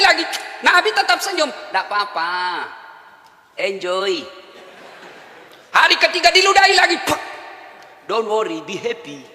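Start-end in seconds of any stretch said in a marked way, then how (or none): 1.5-4.2 quiet, gentle "it's okay"; calm him down softly; hushed easygoing reassurance. Acted. Prophet's soft reassurance. 7.1-9.2 quiet, light and teasing; trailing into a soft laugh; soft playful reassurance. Acted. Prophet's soft playful line.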